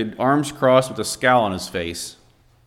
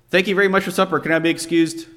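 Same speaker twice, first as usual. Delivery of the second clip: rushed in one breath, higher pitch; run-on rush; 5 semitones higher than usual. Retold or imitated. Brother at supper rushing thanks so he can leave, gabbled. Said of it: "in one long, long run-on sentence".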